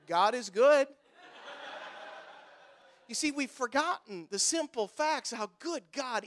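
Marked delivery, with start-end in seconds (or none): none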